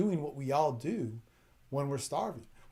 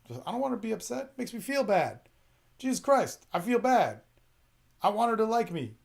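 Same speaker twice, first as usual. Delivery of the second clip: higher, pleading whine; mock whine; 6 semitones higher, 4 dB louder than usual. A guy mocking his own guilt to viewers, higher whine. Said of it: "I'll whine".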